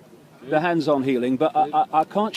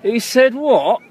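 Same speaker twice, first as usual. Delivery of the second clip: incredulous; 8 semitones higher, 7 dB louder, slower than usual. Retold or imitated. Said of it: "people are going".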